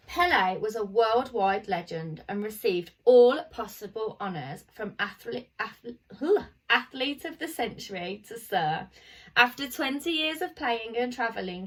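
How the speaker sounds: ordinary delivery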